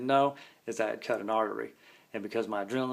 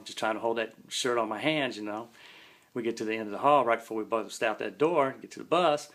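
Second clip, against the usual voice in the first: usual speaking voice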